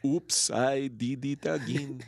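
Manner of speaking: slow, badly faked French accent; terrible French accent. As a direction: drag it out in a hammy French accent. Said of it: "That was a terrible French accent".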